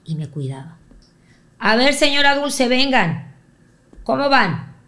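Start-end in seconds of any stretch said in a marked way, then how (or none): 1.6-4.8 hearty Raúl greeting. Retold or imitated. Raúl greets mother warmly.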